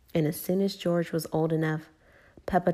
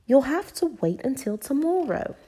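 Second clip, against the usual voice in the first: higher, sing-song motherly voice; Mummy; 9 semitones higher, 4 dB louder than usual. A narrator's reading. Mummy making Peppa wait, motherly. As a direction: put the child off until later. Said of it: "replied Mummy".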